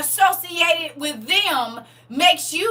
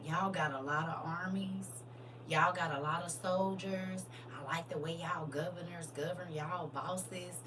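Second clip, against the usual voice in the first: hushed sly voice; 8 semitones lower, 17 dB quieter than usual. Acted. Voicing Jerusalem bargaining.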